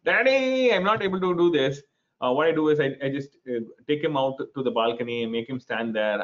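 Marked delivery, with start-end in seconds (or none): 0.1-1.8 put-on high wailing child's whine; imitating a crying child. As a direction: wail it like an upset son. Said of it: "my son is shouting, he's crying".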